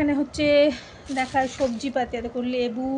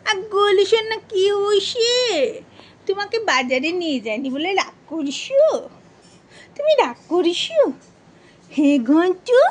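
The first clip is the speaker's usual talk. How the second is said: high sing-song coo, much higher than her chat; 7 semitones higher, 5 dB louder than usual. Real, not acted. Coaxing her sulking pet in high cooing baby talk.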